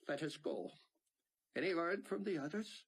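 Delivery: put-on high, breathy Israeli accent. A narrator's reading. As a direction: voice him with a thick Israeli accent. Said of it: "in his thick Israeli accent".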